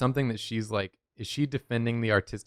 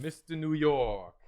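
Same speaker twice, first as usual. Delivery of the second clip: slow, drawn-out New York announcer; New York accent; 5 semitones higher, slower than usual. Acted. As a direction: drawl the name in a thick New York accent. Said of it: "doing the New York voice".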